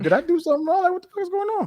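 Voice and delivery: high-pitched mock bewilderment; retold "what did I do?" panic, pitched very high; bewildered protest. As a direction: act out baffled disbelief, voice shooting way up. Retelling bewildered reaction to coach's outburst.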